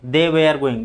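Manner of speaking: "were" as ve-ar, r heard; wrong pronunciation. Shown as a demonstration. Teacher repeating the mistake, "were" said as वेयर. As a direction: make "were" sound like wear. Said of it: "but गलत है".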